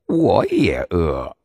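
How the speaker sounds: slow fox voice; a hungry fox sighing back; 狐狸慢慢说我也饿. A narrator's reading.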